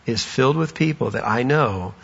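soft, low and reassuring; gentle Jesus. Acted. Jesus comforting the worn-out Paul, soft and low. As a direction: ease the weary man's doubt gently. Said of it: "And Jesus just says".

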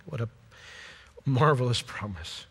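ordinary talk

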